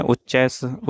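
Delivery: ordinary talk, nothing marked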